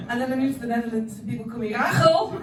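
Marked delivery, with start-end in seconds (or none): 1.2-2.3 Rachel with a harsh Dutch guttural G sound, RA-khol; Dutch pronunciation. Shown as a demonstration. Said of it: "Dutch is not sexy".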